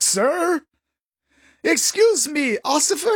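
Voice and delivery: high huffy outrage; indignant. Pretend. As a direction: rise up offended, high. Skit, drunk driver offended.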